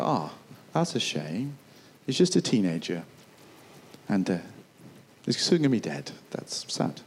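soft, pitying sigh fading to a hush; a soft-hearted soldier's gentle pity; mock sad. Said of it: "'cause they go".

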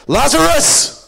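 huge, high commanding shout; loud calling out. Acted. Jesus calls into Lazarus's tomb, loud calling out. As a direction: bellow the name to summon the dead. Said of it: "called out in a loud voice".

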